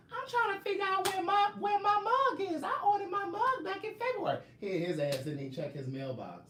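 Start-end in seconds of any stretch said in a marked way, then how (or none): 0.1-4.4 very high squeaky complaining voice; mocking a fan's voice. Mocking Bianca rushing home for the live, squeaky high whine. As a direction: whine like an impatient fan. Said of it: "all in my inbox".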